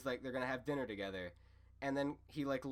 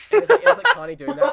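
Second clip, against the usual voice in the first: much higher and louder, laughing through the words; 12 semitones higher, 19 dB louder, faster than usual. Real, not acted. Telling friends about the lo-fi girl, laughing hard. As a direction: break into real laughter mid-sentence. Cracking up at the show.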